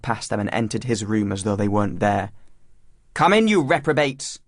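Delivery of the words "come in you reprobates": put-on raised-voice headmaster calling boys in; loud, high-pitched order from a headmaster; stern summons. A narrator's reading. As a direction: call out sternly, higher and louder. Headmaster calls boys into his room.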